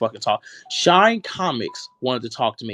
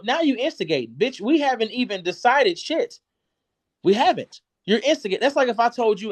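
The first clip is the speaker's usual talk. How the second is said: angry; 6 semitones higher than usual. Real, not acted. Heated argument, rising higher.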